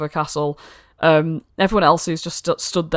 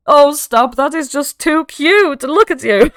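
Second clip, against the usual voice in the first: high, loud, slow gushing over something cute; gushing delight; 9 semitones higher, 7 dB louder, slower than usual. Acted. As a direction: melt over how adorable they are. Godmother gushes over the player.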